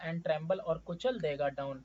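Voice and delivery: ordinary talk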